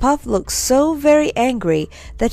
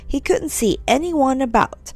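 ordinary delivery

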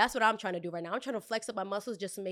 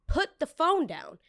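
stern command; 7 semitones higher, 4 dB louder, slower than usual. Retold or imitated. Warning the customer.

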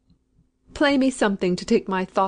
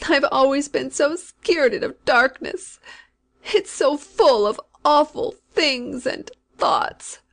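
a touch higher, climbing toward panic; rising fearful voice; 6 semitones higher, 3 dB louder, slower than usual. A narrator's reading. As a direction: let the terror creep up in her voice. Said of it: "Her voice rose again into that shrill, intolerable shriek".

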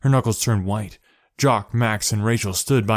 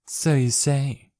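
snapping; 5 semitones higher, much slower than usual. Acted. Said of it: "Kate snapped".

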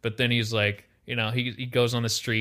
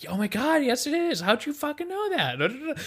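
much higher mock astonishment; imitating amazed onlooker; 14 semitones higher than usual. Tom Brady's wife reacts to the trick, high mock amazement. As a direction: gush in disbelief at the trick.

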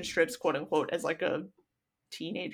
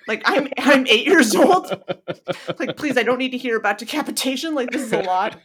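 put-on exasperated kid; 3 semitones higher, 7 dB louder than usual. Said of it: "And I was like".

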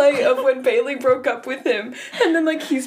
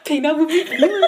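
copying her voice; 3 semitones higher, faster than usual. Retold or imitated. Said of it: "This is the voice I've picked".

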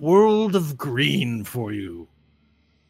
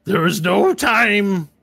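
louder, slow and pressing; urgent; 7 semitones higher, 8 dB louder than usual. Acted. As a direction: insist there's no time. Urgent villain plea.

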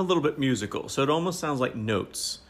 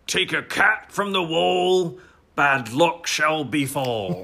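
slow, higher, grand proclamation of the rhyme; mock solemn recital; 4 semitones higher, slower than usual. Friend performs the rhyme for her co-host, in a slow grand proclaiming voice. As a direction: intone it like an ancient village oracle. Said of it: "can I do the joke? I wanna say it".

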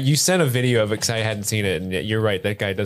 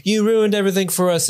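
raised, higher mock complaint; accusing friends; 8 semitones higher than usual. Kicked-out friends blaming him, mock upset. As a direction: whine it as an accusation. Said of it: "they're all like".

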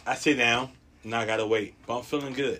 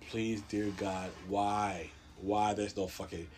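soft, low retold prayer; quiet pleading; 4 semitones lower, 7 dB quieter, slower than usual. Patient begging God over a broken lock, quiet pleading.

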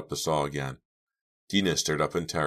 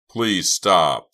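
loud, high begging cry; frantic shouted please; desperate plea; 4 semitones higher, 11 dB louder than usual. Acted. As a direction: beg him loudly to stop. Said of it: "Chuck repeated".

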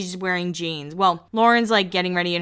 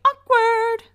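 high, loud yelled "awkward"; [name] yell; 5 dB louder than usual. Retold or imitated. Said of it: "[name] will always say".

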